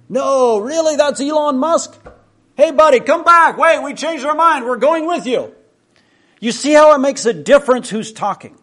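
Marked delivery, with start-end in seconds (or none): 0.1-5.5 higher and louder, eager disbelief then shouting him back; suddenly thrilled, call the rich man back; excited call-back. Acted. Buddy realizes it's Elon Musk.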